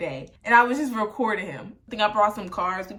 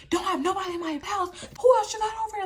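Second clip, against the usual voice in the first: retold yelling; 10 semitones higher, faster than usual. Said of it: "Like, yelling".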